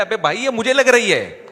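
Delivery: loud mock cry of pain; pained yell. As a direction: yell out as the beating gets worse. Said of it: "جب اس کو زیادہ مارا ہے".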